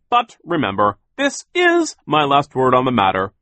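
high, loud, imperious little boss; bossy Sir. A narrator's reading. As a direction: order him about, loud and high. Sir demands a milkshake.

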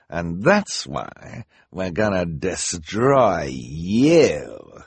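very slow, drawn-out menace; villainous threat. A narrator's reading. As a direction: stretch out each word to threaten the children. Villain threatens to destroy the children.

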